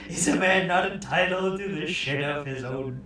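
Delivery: loud, hammed-up grievance; mock indignant shout. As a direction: protest loudly, mock serious. Mock bathroom protest.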